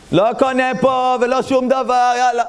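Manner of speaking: high-pitched huffy refusal; indignant customer. Acted. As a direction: act out a customer quitting the shop. Acted customer storming out of shop.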